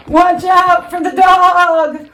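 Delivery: high, slow sheep cry. A narrator's reading. Sheep warning Wilbur, high slow bleat. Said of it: "cried the sheep".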